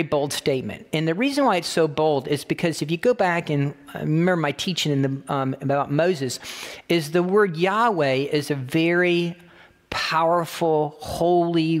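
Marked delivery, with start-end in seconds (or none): none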